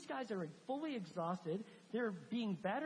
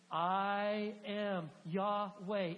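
very slow, louder, solemn divine voice; voice of God; 5 dB louder, much slower than usual. Acted. God answering Moses from the burning bush, slow and louder.

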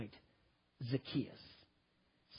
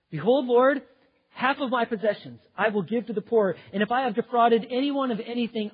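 loud, high proclamation; ringing, emphatic vow; fervent declaration; 6 semitones higher, 8 dB louder than usual. Acted. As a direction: declare a pledge with full voice. Zacchaeus declaring his pledge to Lord.

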